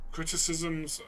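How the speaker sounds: normal talking voice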